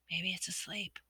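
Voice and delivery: soft breathy whisper, no voice; breathed whisper. A narrator's reading. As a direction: barely breathe the words out. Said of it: "he breathed".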